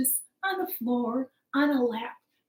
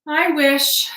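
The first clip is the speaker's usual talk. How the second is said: loud, raised story-character voice; declared wish; 4 semitones higher, 8 dB louder than usual. Acted. Wife in the tale making her wish, loudly declared. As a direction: proclaim it loudly, pitched up.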